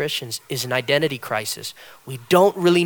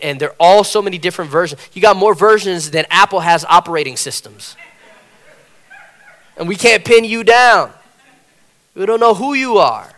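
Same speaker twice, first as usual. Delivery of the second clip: loud, rising sermon delivery; a swelling pulpit voice; preaching; 6 semitones higher, 9 dB louder than usual. Acted. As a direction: preach it out to the congregation. Preaching at full force.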